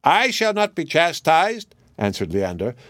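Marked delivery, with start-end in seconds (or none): 0.0-1.7 high, slow indignant parrot squawk. Acted. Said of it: "still counterfeiting the parrot's voice".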